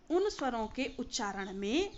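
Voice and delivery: ordinary talk, nothing marked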